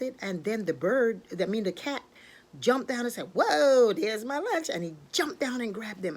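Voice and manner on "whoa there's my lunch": loud, high whoa, easing off; excited pouncing cat. Acted. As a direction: burst out like a cat spotting lunch.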